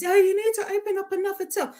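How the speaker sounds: high, loud, hurried customer complaint; mock customer demanding service. Acting an impatient customer at the till.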